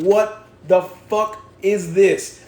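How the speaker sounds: drawn-out, disgusted what-the-fuck, slow and loud; exasperated outburst. Retold or imitated. To himself over a boring book, slow exasperated disbelief. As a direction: let the disgust stretch every word. Retelling frustration reading a book.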